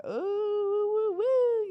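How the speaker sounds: high excited hooting; hyped-up cheering. Retold or imitated. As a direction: hoot like you're gassing up a friend. Friends hyping up her news.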